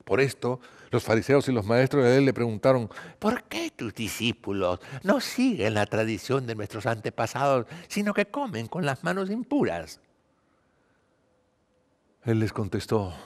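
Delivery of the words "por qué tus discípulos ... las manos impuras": theatrical questioning voice with swooping pitch; questioning Pharisees. A narrator's reading. Pharisees and teachers asking Jesus about his disciples, questioning voice. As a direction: put on an accuser's questioning voice, softly.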